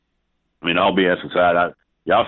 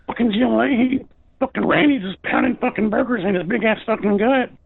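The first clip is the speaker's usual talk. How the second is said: Bubbles voice; 12 semitones higher than usual. Retold or imitated. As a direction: hold the squeaky Bubbles pitch. Keeps the high Bubbles voice.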